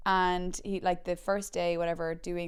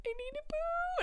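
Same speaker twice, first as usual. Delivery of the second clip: mock wail; 4 dB quieter than usual. Desperate kid on a surfboard, high mock wail. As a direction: wail it in a tiny squeaky voice. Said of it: "they still laugh me to this day that I was like".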